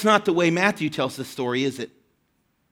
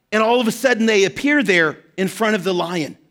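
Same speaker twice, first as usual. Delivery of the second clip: ordinary delivery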